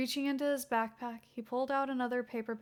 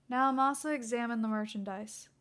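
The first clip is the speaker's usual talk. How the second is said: ordinary delivery, nothing marked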